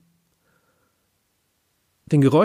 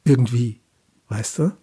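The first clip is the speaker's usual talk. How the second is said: a bit lame; 3 semitones lower than usual. A narrator's reading. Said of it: "ein bisschen lahm füge ich hinzu".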